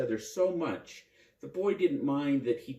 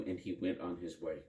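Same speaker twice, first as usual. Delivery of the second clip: usual speaking voice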